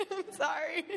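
giggling through a sorry, pitch jumping high; real embarrassed laugh as she says sorry; laughing apology. Real, not acted.

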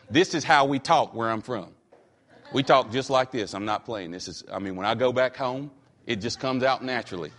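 low, soft Western North Carolina country accent; Western North Carolina dialect. Shown as a demonstration. Preacher showing congregation how they talk back home, Western North Carolina accent. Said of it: "turn off my distinguished, uh, uh, dialect".

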